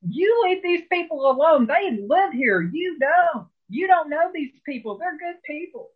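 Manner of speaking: waitress scolding the out-of-state heckler, high and fierce; scolding grandmother. Retold or imitated. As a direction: tell the bully off like a fierce grandma.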